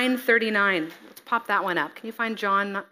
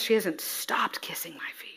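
hushed tender words; 3 semitones lower, 6 dB quieter than usual. Acted. Jesus speaking tenderly.

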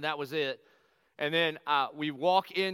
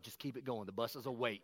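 much softer, hushed urging; 7 semitones lower, 10 dB quieter than usual. Retold or imitated. Pastor quietly urging his singing wife to keep going, hushed. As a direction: urge her on under your breath. Coaching wife silently mid-song.

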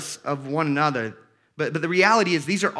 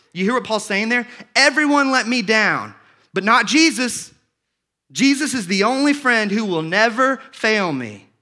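higher and louder, building to a cry on "let me down"; preaching at full force; 5 semitones higher, 7 dB louder than usual. Acted. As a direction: preach it out to the whole room. Preaches Paul's words at full force.

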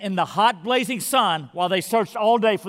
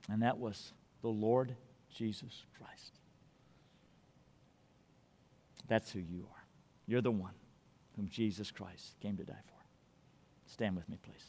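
very soft, low and solemn; grave, intimate low delivery; hushed preaching; 11 semitones lower, 17 dB quieter than usual. Real, not acted. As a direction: let the sermon sink to a near murmur. Hushed climax of sermon.

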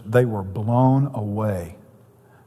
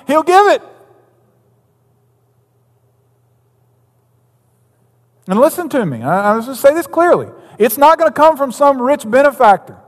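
louder and higher, building preacher's fervour; preaching at full force; 10 semitones higher, 9 dB louder than usual. Acted. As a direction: preach it out to the whole church, slowing on key words.

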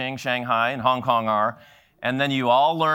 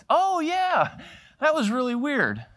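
son's breezy reply; 12 semitones higher, slower than usual. Retold or imitated. Son retells scene to dad.

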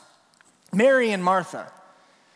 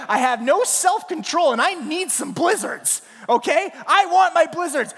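higher, louder indignant customer rant; angry rant; 7 semitones higher, 4 dB louder than usual. Retold or imitated. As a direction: rage at the closed shop like a spoiled customer. Retold own rant at Dairy Queen.

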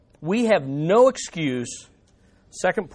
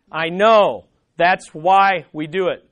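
firm reply; 6 dB louder than usual. Acted. Preacher answers objector firmly.

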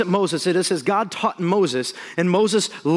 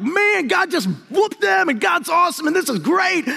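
much higher, a big joyful whoop of praise; hyped-up celebrating; 10 semitones higher than usual. Retold or imitated. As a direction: whoop it up like a crowd partying for God. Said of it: "they're just getting down like".

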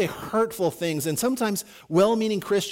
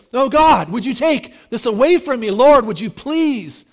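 loud, high, anguished begging cry; Paul crying out to God, desperate loud plea; desperate pleading; 5 semitones higher, 7 dB louder than usual. Acted. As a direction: beg God loudly to take the thorn away. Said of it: "he is desperately pleading".

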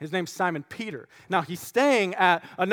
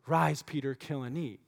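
quiet divine command; 5 semitones lower, 7 dB quieter than usual. Acted. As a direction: let the command sink to a hush. Voice of God repeated.

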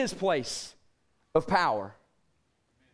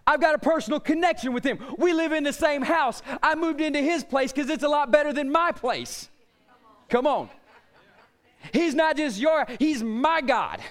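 fervent preaching; 3 semitones higher than usual. Acted. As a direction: preach it like a personal boast. Preacher insists God is his.

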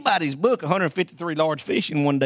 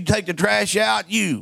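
wife's sudden order; 3 semitones higher, slower than usual. Retold or imitated. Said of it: "My wife's done me that way".

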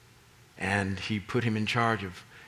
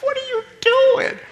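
laughing. Real, not acted. Laughing at mink joke.

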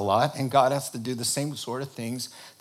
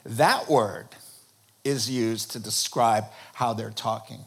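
normal talking voice

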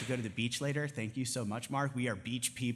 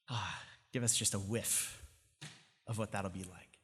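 prayer; 4 semitones lower than usual. Acted. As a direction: close the sermon in hushed prayer. Closing prayer.

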